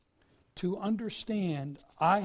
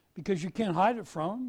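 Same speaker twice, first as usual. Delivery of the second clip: ordinary delivery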